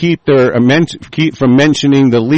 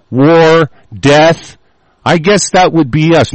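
ordinary talk

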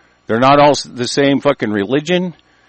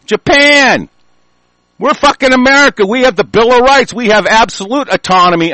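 angry outburst; 6 semitones higher than usual. Real, not acted. Angry political rant.

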